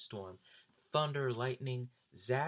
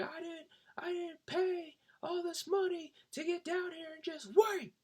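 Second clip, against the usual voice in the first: much higher mock voice of a rowdy fan; Brian complaining. Drunk Brian gripes about the rain delay, high mock voice. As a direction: squeak it out like an obnoxious drunk. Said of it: "he's like".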